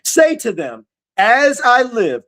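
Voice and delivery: slow, high, ringing scripture reading; preaching scripture. Acted. Preacher reading God's words to the congregation, slow and ringing. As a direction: proclaim each phrase slowly as the voice of God.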